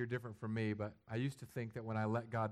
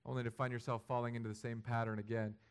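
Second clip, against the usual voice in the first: usual speaking voice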